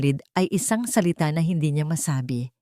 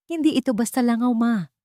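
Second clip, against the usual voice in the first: insistent, higher and quicker, edged with panic; trace of panic; 7 semitones higher than usual. A narrator's reading. Marco insisting to his mother it is serious, a trace of panic. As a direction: push back urgently with a touch of panic. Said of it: "may bakas ng panik sa kanyang boses".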